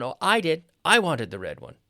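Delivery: eager high, loud I; I emphasized loudly, answering up; stress on I. Shown as a demonstration. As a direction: claim it, punching I and falling after. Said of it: "not someone else".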